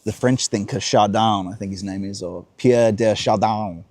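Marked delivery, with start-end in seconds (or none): none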